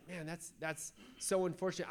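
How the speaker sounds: softly disappointed, halting; a touch of sorrow, voice dropped; understated, quiet letdown; sad. Acted. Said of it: "'cause he was sad".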